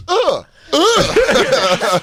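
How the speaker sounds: mock grunts. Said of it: "He goes".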